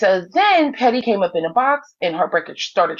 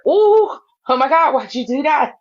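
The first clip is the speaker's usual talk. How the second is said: mimicked scandalized crowd; 5 semitones higher than usual. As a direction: act out a shocked audience. Imitates crowd's scandalized reaction.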